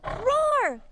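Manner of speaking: mock tiger roar. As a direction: roar like a friendly tiger for kids.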